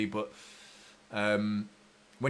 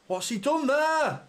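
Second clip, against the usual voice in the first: high, slow cry of disbelief; can't believe what he just saw; outraged disbelief; 11 semitones higher, 3 dB louder, slower than usual. Real, not acted. Fan yelling at his screen in disbelief. Fan reacts to defensive blunder.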